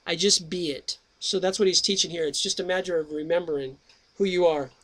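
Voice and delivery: usual speaking voice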